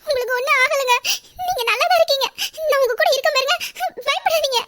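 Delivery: high, laughing soothing; nervous reassurance. Acted. Wife soothes her frightened husband, high nervous laughing reassurance. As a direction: comfort him with a nervous laugh.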